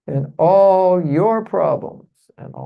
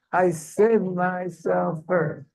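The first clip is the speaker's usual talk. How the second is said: very slow, higher, emphatic affirmation; like you mean it; 4 semitones higher, much slower than usual. Shown as a demonstration. Teacher models the affirmation for the audience, slow and emphatic. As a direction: draw each word out with conviction. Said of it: "Say it like you mean it".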